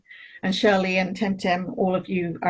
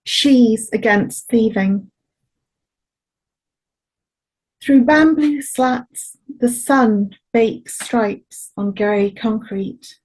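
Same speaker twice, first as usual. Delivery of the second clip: slow poetry voice, spaced phrases; poem reading; slower than usual. Acted. Poet reading a poem about their elders, slow measured recital. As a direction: set each image down slowly between pauses. Reads the next poem aloud.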